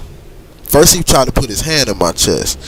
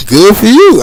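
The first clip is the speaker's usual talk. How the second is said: sarcastic thought; 8 semitones higher, 9 dB louder than usual. Retold or imitated. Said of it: "just thinking in my head".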